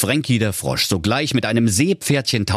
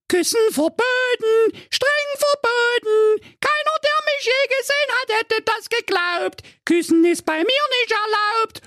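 extremely high squeaky ranting; indignant squealing fairy-tale girl; outraged princess Carola. Acted. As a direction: forbid the kiss in a piping shriek. Voices Carola forbidding kiss.

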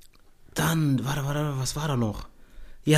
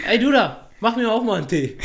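put-on high, loud brash kid calling out to the teacher; cheeky kid; 8 semitones higher, 3 dB louder than usual. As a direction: bark it at the teacher like a rude little boy. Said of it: "macht so".

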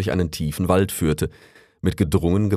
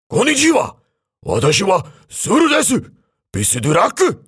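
put-on high, loud crane with a Japanese accent; 13 semitones higher, 5 dB louder than usual. A narrator's reading. Crane greeting the raccoon from the roof, high and loud. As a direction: greet them brightly, pitched way up.

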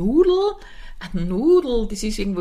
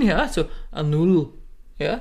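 Viennese; 3 semitones lower than usual. Retold or imitated. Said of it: "wie eine Wienerin".

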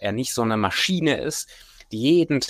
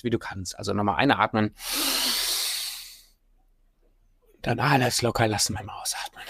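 sultry narrator. Acted. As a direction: talk them calmly through each breath. Leads a breathing exercise.

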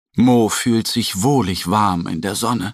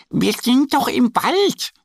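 much higher duck voice; proud little duck. Acted. Annie shows off her costume, high proud voice. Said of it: "meint Annie".

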